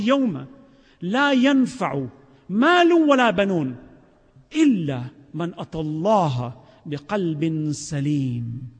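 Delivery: slow, measured Arabic recitation; Quranic recitation. Real, not acted. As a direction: draw out each phrase of scripture solemnly.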